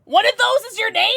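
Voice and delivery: high, loud incredulous question; can't believe what she read; surprised disbelief. Real, not acted. Streamer reacting to a viewer's reveal, loud surprise.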